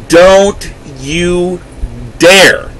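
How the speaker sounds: don't you dare, with a pause before the last word; broken-up furious warning. Real, not acted. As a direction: spit it out word by word, hitting dare.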